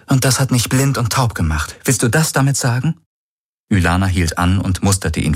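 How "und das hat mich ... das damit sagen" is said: higher female character voice, demanding; raised pitch, demanding reply; challenging sister. Acted. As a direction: put it to a brother as a pointed question. Challenges her brother in the story.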